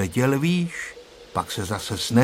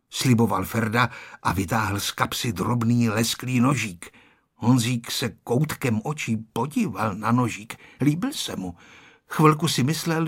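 plain reading voice

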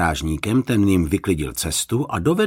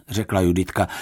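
plain reading voice

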